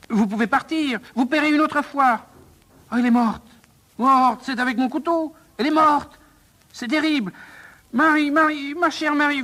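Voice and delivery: high-pitched frantic wailing; panicked. Acted. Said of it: "pris de panique".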